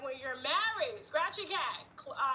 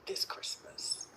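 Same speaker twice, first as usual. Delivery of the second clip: whispering; 7 dB quieter, slower than usual. Acted. Whispered aside.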